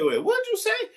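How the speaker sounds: can't believe what was just said; teacher stunned by the threat, high disbelief; incredulous. Retold or imitated. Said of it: "I said".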